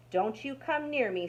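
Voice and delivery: a mild snarl from the grouchy dog. A narrator's reading. Dog warns Rat away, a mild snarl. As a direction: warn Rat off like a dog, lightly. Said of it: "snarled Dog".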